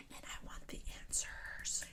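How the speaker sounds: hushed, breathy aside to the viewers; whisper. Real, not acted. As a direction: drop to a secret whisper. Eager reviewer aside to viewers.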